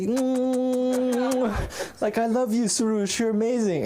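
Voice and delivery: high, drawn-out hum into gushing praise; high-pitched adoring grandpa voice; kissy doting grandfather. Retold or imitated. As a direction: imitate grandpa smothering a grandchild with love. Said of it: "he comes to me and he gives me kisses".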